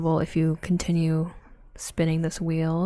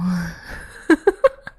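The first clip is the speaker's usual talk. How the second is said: helpless laugh; 7 dB louder than usual. Real, not acted. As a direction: crack up at your own accidental innuendo. Cracks up at own innuendo.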